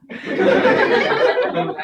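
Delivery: big burst of laughter; loud laugh. Real, not acted. Laughs at own joke.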